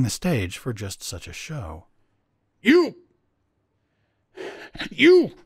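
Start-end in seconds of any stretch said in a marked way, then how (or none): 2.6-5.3 high, loud sputtered outburst; too furious to speak properly; sputtering rage. A narrator's reading. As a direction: choke out the words in fury. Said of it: "sputtered Clagett".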